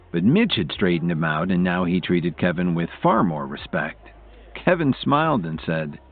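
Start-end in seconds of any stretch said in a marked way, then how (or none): none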